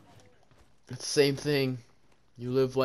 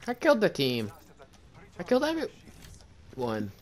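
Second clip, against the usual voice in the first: loud, higher victory brag; triumphant boast; 6 semitones higher, 8 dB louder than usual. Real, not acted. Player boasting to teammates after a wipe, loud and higher. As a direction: crow about wiping the squad.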